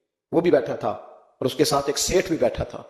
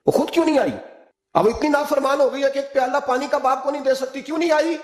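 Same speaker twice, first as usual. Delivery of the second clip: angry father; 9 semitones higher than usual. A narrator's reading. Father scolds wife over daughter's absence, high and angry. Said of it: "حضرت عمر بن عبدالعزیز کو غصہ آیا".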